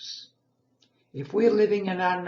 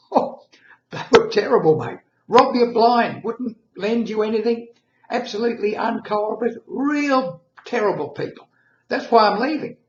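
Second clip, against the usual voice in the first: put-on higher and louder, griping about his old neighbours; indignant complaining; 7 semitones higher, 8 dB louder than usual. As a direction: rant that the last town robbed him blind, voice raised. Driver complains about old town.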